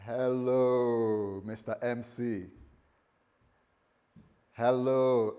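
lightly voiced God, drawn-out greeting; a hint of a divine visitor calling hello. God greeting someone who appears in their room, lightly put-on divine voice. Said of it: "If God appeared in your room".

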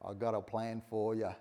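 low mock mobster voice; hammed-up mobster on the phone; mafia don. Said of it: "like the mafia don in Italy".

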